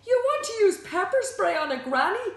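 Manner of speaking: high, loud incredulous question; shrieked-out, horrified question; aghast disbelief. A narrator's reading. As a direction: exclaim it, utterly scandalised. Shocked at the pepper spray plan.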